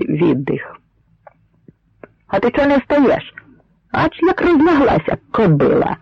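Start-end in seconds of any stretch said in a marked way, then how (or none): 2.3-6.0 much higher-pitched, rough-mannered soldier's shout; bully her from high in the voice; indignant yell without full volume. A narrator's reading. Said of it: "крикнув найдужчий".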